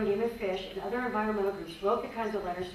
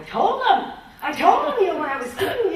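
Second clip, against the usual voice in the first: louder and higher, bursting with a laugh; loud heated telling; 10 semitones higher, 12 dB louder than usual. Shown as a demonstration. Range con demoing her old heated meeting voice, loud. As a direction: blurt it out hot and fast. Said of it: "and I'm like this".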